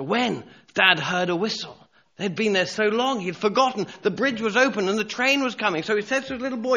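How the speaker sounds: high, pressing sermon narration; urgent storytelling. A narrator's reading. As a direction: tell the crisis with rising alarm.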